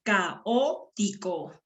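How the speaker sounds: "Caótico" slowly split as ca-ó-ti-co; "Caótico" in slow, separate syllables. Shown as a demonstration. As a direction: draw out each syllable of "Caótico". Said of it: "Vamos a silabear".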